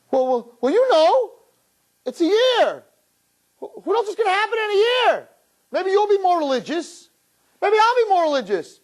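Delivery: very high mock excuse-making voice; high, hammed-up stalling; squirming boyfriend.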